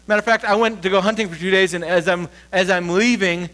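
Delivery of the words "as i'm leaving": ordinary talk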